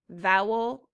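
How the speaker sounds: normal talking voice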